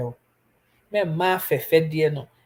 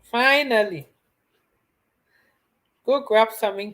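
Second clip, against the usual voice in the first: teasing, raised voice to Eva who finally woke; loud relieved call; 5 semitones higher, 4 dB louder than usual. Real, not acted.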